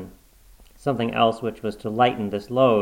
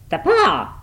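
high, loud outburst; frantic, raised-pitch exclamation; wild cry; 11 semitones higher, 5 dB louder than usual. A narrator's reading. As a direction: cry out wildly in sudden realization. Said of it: "she cried wildly".

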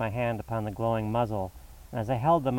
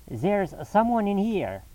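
higher, slow urgent cry; cried out; 10 semitones higher, 4 dB louder, slower than usual. A narrator's reading. Lestrade cries out at the locked bedroom door, urgent. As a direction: call it out, alarmed. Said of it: "cried Lestrada".

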